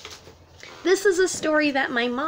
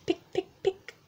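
soft pecking sound; 7 semitones higher, 5 dB quieter, faster than usual. A narrator's reading. Egg pecking, getting softer.

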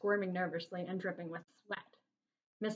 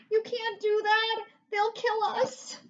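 high, loud, slow desperate plea; terrified boy begging; frightened pleading; 7 dB louder, slower than usual. A narrator's reading. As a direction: beg in panic. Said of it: "cried George".

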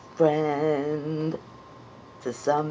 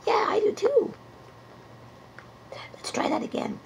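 high whispered puppet; much faster than usual. Acted.

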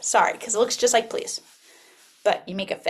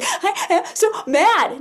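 mock child tantrum; 7 semitones higher, much slower than usual. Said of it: "and be like".

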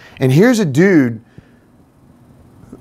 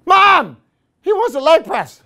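calling out; 11 semitones higher than usual. Retold or imitated. Narrator calling out to the mom.

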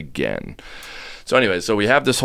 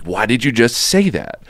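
higher-pitched, mildly mock holler; retold hollering; 6 semitones higher, 4 dB louder than usual. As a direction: press the question like a friend hollering, half-strength. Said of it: "he's hollering at me".

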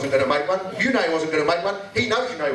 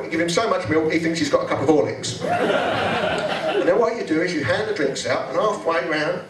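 usual speaking voice

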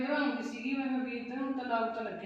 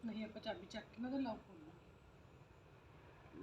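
conspiratorial hush; 3 semitones lower, 12 dB quieter, slower than usual. Acted. Plotting to open the lock.

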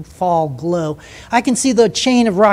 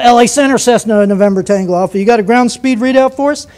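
pilot keying the mic, loud and clipped; imitating a small-plane pilot on the radio; radio call; 3 semitones higher, 4 dB louder than usual. Pilot calling Center on radio.

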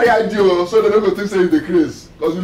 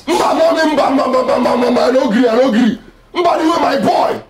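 excited shouting; 7 semitones higher than usual. Acted. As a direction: shout the refusal, half laughing. Son shouting at mother on phone.

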